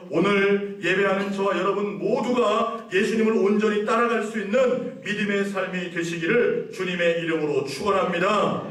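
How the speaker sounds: ordinary talk, nothing marked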